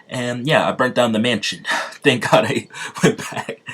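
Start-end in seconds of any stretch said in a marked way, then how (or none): 2.0-3.7 laughing through the words. Real, not acted. Player relieved about the mansion, laughing. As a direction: break up with laughter while talking.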